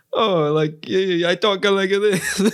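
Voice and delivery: lightly put-on sitcom Italian accent; a hint of a fake Italian accent. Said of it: "pretend to do an Italian accent".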